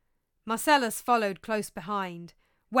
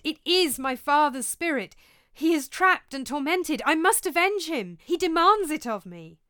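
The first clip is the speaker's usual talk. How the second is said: loud, higher-pitched fervour; urgent, driven declaration; impassioned insistence; 8 semitones higher, 8 dB louder than usual. Acted. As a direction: insist on it with rising passion. Hamlet insists on avenging father.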